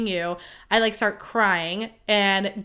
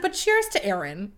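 understated counter-toast, in contrast to cheering; modest redirect; 3 dB quieter than usual. Retold or imitated. Maid of honor passing the toast to the bride, understated. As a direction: deflect the toast to someone else.

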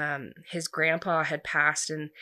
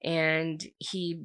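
ordinary talk

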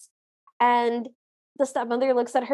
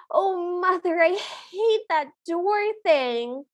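high, slow whiny complaint; mildly hammed-up, higher-pitched protest; Arlene; 6 semitones higher, slower than usual. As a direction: imitate a stepsister dragging out a moan. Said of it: "the way that Arlene speaks".

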